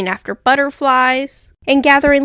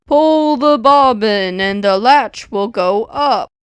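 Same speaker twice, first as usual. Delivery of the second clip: slow, softened wolf voice; a wolf forcing his voice gentle; the wolf faking the sick grandmother, slow and softened; wolf softening his voice; slower than usual. A narrator's reading. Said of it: "softening his voice as much as he could".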